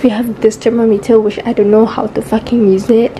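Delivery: ordinary talk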